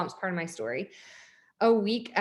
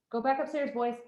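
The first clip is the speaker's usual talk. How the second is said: parent aside; 4 semitones higher than usual. Real, not acted. Mother calls to her kids.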